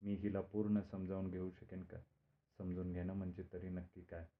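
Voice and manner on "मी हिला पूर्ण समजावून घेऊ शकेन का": ordinary delivery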